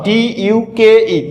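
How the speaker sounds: letters drawn out one at a time; slow deliberate spelling. Shown as a demonstration. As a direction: dictate each letter slowly and clearly. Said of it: "ভালো করে বানানটি খেয়াল করো".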